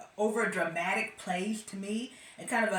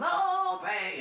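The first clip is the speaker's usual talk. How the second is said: mock preacher; 10 semitones higher, 3 dB louder than usual. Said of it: "He goes".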